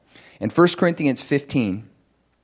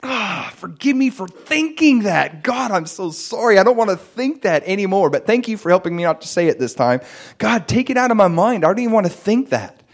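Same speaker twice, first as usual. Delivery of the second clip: pleading prayer; 7 semitones higher than usual. Retold or imitated. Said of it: "and I'd be like".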